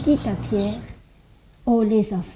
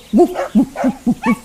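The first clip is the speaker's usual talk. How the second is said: mock dog woofs, a bit louder; dog barking; 3 dB louder than usual. Storyteller imitating a barking dog for children. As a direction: bark like a little dog for the kids. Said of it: "qui aboie".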